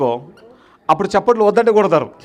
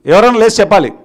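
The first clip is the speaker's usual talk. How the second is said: ordinary talk, nothing marked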